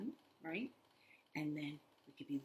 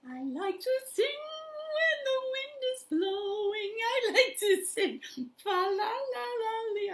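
sung much higher and louder; 6 dB louder than usual. Pretend. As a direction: sing it in a squeaky high voice. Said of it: "sing it with a high voice".

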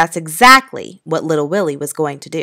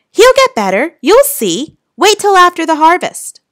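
high, loud pleading insistence; a boy refusing to hear the bad news, crying out; desperate insistence; 12 semitones higher, 6 dB louder than usual. Boy insists grandfather will recover.

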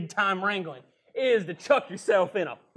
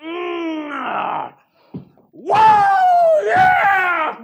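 pained shout; 9 semitones higher, 10 dB louder, much slower than usual. Acted. Reacting to tobacco dip.

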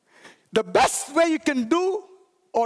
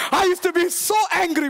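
ordinary talk

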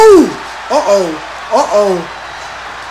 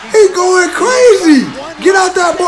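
ordinary talk

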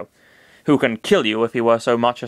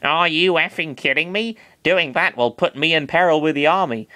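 Are you effing kidding me read in Chris's slightly higher voice; wrong voice; 4 semitones higher than usual. Acted. Master Hand's line read first by mistake in Chris's voice. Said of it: "that was actually Master Hand talking".

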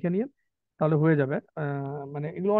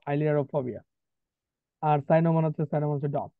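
normal talking voice

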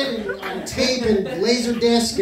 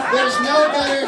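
ordinary delivery

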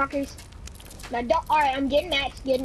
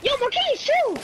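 yelling from the sidelines; 9 semitones higher than usual. Real, not acted. Calling to teammate mid-fight.

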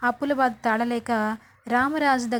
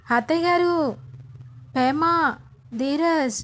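higher, louder calling out the family's names; a raised, urgent shout across the house; 4 semitones higher than usual. Acted. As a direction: yell for everyone to come running. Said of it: "గట్టి గట్టిగా అరుస్తూ ఉంటుంది".

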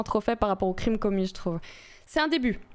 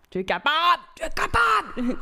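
cheering on; 6 semitones higher than usual. Real, not acted. Streamer cheers on a viewer, high hyped shout. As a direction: belt it out like a hyped-up supporter.

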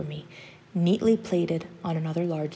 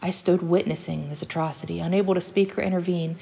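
plain reading voice

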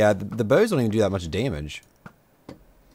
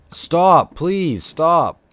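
high, loud begging yell; real panicked plea at attacking Endermen; pleading shout; 8 semitones higher, 7 dB louder, much slower than usual. Real, not acted. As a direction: beg the mobs to stop, shouting.

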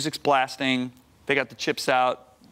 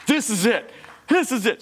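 excited; 8 semitones higher than usual. Retold or imitated. Excited realization retold.

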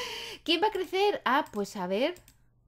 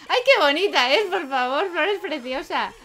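higher, louder delighted squeal; gushing; 5 semitones higher, 7 dB louder than usual. Real, not acted. Gushing over a baby Sim.

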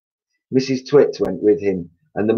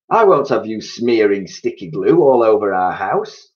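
scolding wife; 3 semitones higher, 5 dB louder than usual. A narrator's reading. Mrs Twit scolds.

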